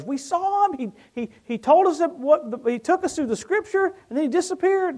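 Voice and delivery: thrilled disciples bursting with news; excited disciples. Acted. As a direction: gush it out like eyewitnesses.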